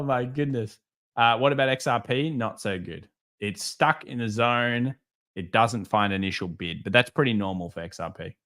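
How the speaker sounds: normal talking voice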